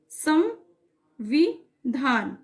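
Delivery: संविधान drawn out very slowly, syllable by syllable; very slow pronunciation. Shown as a demonstration. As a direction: let the class hear each sound of संविधान. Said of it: "उच्चारण में". (संविधान बहुत धीरे, एक-एक अक्षर)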